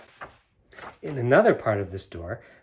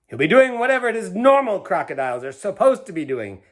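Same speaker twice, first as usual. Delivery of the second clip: sharp, louder retort; snapped; 8 semitones higher, 8 dB louder than usual. A narrator's reading. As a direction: cut her off rudely. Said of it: "snapped Mister Grumps".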